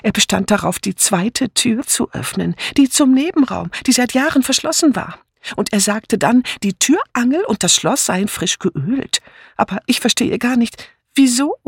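put-on high-pitched, agitated old friend blurting news; excited. A narrator's reading. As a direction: gush it out in a high voice. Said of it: "rief nur Miss Banner aufgeregt". (aufgeregt, mit hoher Stimme)

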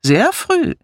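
high-pitched reproach; disapproving, pitched high; reproachful. A narrator's reading. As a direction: add it pointedly, voice jumping up. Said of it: "fügte vorwurfsvoll hinzu".

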